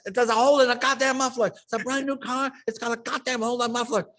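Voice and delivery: hammed-up angry father yelling; furious dad. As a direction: rant about the muffler.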